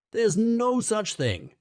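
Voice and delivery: high, slow, indignant protest; angry outburst. A narrator's reading. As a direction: burst out at them in anger. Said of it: "very angrily".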